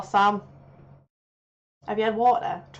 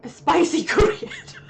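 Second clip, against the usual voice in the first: laughing disbelief; 8 semitones higher, 6 dB louder, slower than usual. Real, not acted. Amused disbelief at new term.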